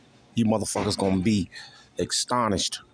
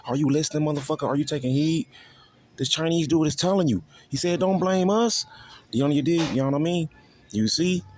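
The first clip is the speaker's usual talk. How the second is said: higher, urgent preaching voice, pressing the listeners hard; heated preaching; 6 semitones higher than usual. Acted. Street preacher warning listeners about what's coming, heated preaching. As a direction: rise up and preach it at them.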